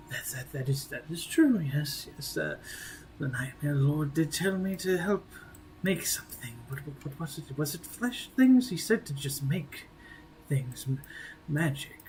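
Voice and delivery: soft, halting, absent-minded mutter; mumbling to himself. Acted. Befuddled elf recalls Nightmare Lord's orders, mumbling to himself. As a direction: lose the thread and mumble under your breath. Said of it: "continues mumbling to your, to, to himself".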